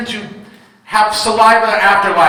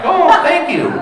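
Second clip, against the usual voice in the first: high, bright fake thanks; mock grateful; 9 semitones higher than usual. Dad thanking toddler for a soggy snack, fake delight. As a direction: gush a fake thank you.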